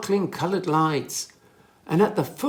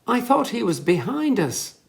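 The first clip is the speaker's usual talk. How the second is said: a mild, high wail of worry; lightly voiced worried wail, a touch of panic; understated wail; 8 semitones higher, 3 dB louder than usual. A narrator's reading. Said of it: "wailed Millie".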